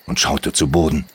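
ordinary delivery, nothing marked

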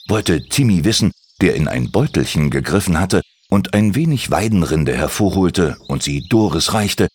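ordinary delivery

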